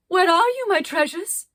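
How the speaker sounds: high, loud anxious call; calling again. Acted. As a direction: call out for the treasures. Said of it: "she called again".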